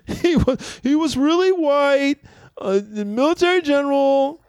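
very high, drawn-out mock protest; put-on high voice of denial; mocked objection. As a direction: stretch it high like a ridiculed objector. Mocking objectors' Hannibal claim.

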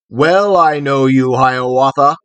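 loud booming cry; thundering voice. A narrator's reading. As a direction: roar it out like thunder. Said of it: "cried he in a voice of thunder".

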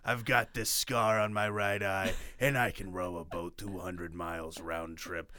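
low, hushed noir detective voice; a lightly growled pulp investigator intro; mock detective persona. Co-host pretending to be a tough newspaper detective, low and hushed.

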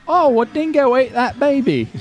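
high, slow hammed-up Australian woman; mock Australian lady. Retelling what the lady said, hammy Australian accent. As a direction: drawl it like the famous dingo lady. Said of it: "that lady saying".

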